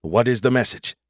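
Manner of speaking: plain reading voice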